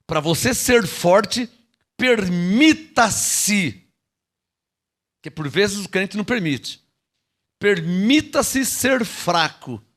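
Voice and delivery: slow, drawn out, leaning on ser; drawn-out pulpit emphasis; slow emphatic preaching. Acted. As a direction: stretch each word as a sermon climax. Preacher stressing key phrase.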